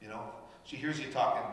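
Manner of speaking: ordinary talk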